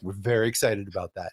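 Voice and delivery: bored ghosts sagging into the floor; deadpan ghosts. Retold or imitated. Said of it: "And they're like".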